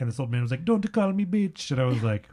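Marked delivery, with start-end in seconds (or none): 0.7-1.7 high, slow mock Italian accent; exaggerated Italian accent, pitched up. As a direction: imitate an indignant old Italian man. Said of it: "make fun of your Italian accent".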